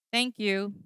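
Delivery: "thank you" on one level note; level intonation. Shown as a demonstration. Agent answers an appreciative customer, sarcastically flat level tone. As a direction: keep "thank you" flat from start to end, no emotion. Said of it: "the level intonation".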